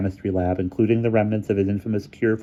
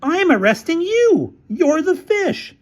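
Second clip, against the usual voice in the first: accusing character voice; 5 dB louder, slower than usual. A narrator's reading. Featherstone accusing.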